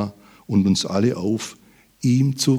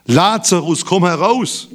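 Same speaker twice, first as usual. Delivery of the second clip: raised, higher calling voice; loud call; 10 semitones higher, 6 dB louder than usual. Acted. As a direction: call it out as a command. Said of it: "rief er mit lauter Stimme". (mit lauter Stimme gerufen)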